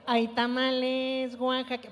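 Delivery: slow, drawn-out vendor call; tamale vendor cry. Shown as a demonstration. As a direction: resonant street cry to warm the voice. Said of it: "técnica muy buena para calentar la voz". (pregón de tamales para calentar la voz)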